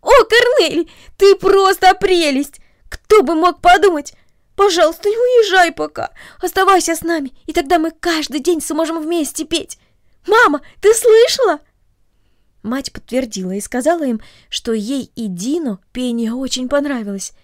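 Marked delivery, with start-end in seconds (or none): 0.0-11.6 put-on high, loud, gushing young girl; a girl bursting with joy, much higher and louder; overjoyed child squealing to her friend; delighted girl.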